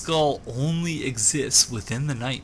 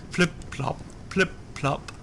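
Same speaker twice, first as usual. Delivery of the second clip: dripping water sounds; 4 semitones higher, 4 dB quieter than usual. Retold or imitated. Imitating dripping water in shaft.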